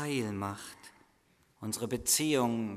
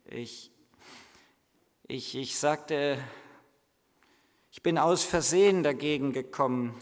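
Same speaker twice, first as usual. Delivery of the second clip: put-on boy crying out the truth, louder at the end; weeping confession; 6 dB louder than usual. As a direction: confess through mild tears. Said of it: "hielt die Hände vors Gesicht und weinte".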